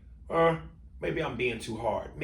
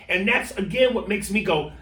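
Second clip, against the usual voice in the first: normal talking voice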